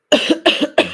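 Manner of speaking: loud, high hacking coughs to clear the chest; real coughing fit. Real, not acted. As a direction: cough hard to get it all out before recording. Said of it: "Let me get my final coughs out".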